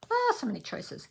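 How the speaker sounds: high delighted exclamation; delighted squeal. Real, not acted. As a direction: sing it out, thrilled.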